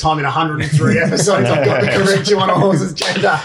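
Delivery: chuckling through the words; laughing while teasing a mate. Real, not acted.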